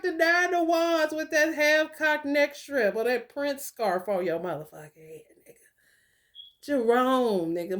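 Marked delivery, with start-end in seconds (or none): 2.2-5.7 whispered aside. Real, not acted. Mocking aside.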